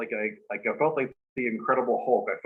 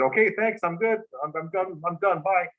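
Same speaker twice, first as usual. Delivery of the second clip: high-pitched mock hasty sign-off; squeaky, panicky impression of a speaker fleeing; flustered presenter quitting; 7 semitones higher than usual. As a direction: imitate someone bailing out in a high voice. Retells flustered presenter abruptly quitting.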